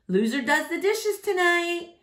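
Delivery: high playful taunt; teasing mom. A narrator's reading. Mom taunting her son over ping pong, playful. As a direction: taunt him mid-rally.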